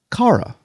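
Cara as CAR-uh, pitched high; corrected pronunciation. Shown as a demonstration. Host promising to call her by her name, Cara said CAR-uh. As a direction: set CARA apart with a high, open AH. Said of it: "I promise from here on out to call you".

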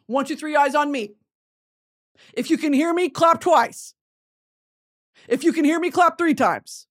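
high, loud classroom call; sing-song teacher calling kids; kindergarten teacher voice. Pretend. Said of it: "calls class to attention".

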